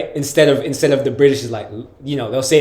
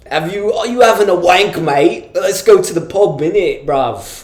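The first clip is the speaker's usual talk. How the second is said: mock country British accent, pitched up at first; 3 semitones higher, slower than usual. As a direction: lay on a laddish British accent. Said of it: "the country British people are like".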